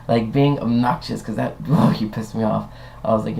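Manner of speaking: normal talking voice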